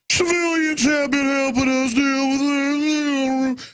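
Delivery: high, slow, sing-song mock of a police chief. Host mocking police chiefs on the news, high slow mock voice. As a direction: mimic that smug official news voice, drawn out. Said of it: "you know, that voice".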